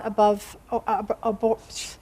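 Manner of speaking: real fumbled reading, restarting the word; tongue-tied stumbling. Real, not acted. Preacher tired of talking misreads the verse, tongue-tied. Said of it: "I think I'm tired of talking".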